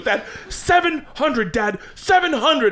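put-on slow, high kid begging; high pleading little girl; urgent kid plea. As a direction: beg dad to bid. Daughter urging dad to bid.

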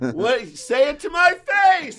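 hammed-up high shout to the face; mock shout. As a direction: yell it so others come running. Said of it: "Like, so loud".

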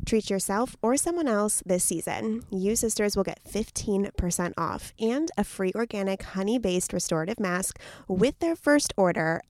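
soft promo code read. Acted. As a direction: spell it out like an ad. Sponsor ad read.

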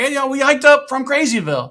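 high, loud call over the river; yelling across. Retold or imitated. Hiker calls across the river to the ranger, yelling. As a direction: yell it to the far bank.

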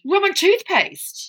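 slow, high, horrified question; appalled disbelief. Real, not acted. Co-host reacts to rum and peppermint with slow, high, appalled disbelief. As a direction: drag it out in shock.

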